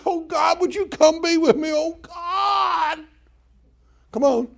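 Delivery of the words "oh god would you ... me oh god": much higher, crying plea; mock pleading prayer. Preacher imitates pleading prayers, high mock crying. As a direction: beg God in a hammed-up wail. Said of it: "We think we gotta say".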